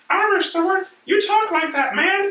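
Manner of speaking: mocking high falsetto character voice; squeaky high voice. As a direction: read the subtitle in a silly squeak. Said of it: "Why does the Irish have such a high voice".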